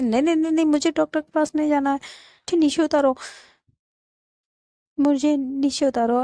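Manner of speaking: mild acted panic at being carried; protesting refusal. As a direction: refuse like a stubborn patient. Refusing doctor, demanding to be put down.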